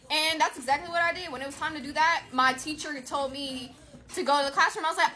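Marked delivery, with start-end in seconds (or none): none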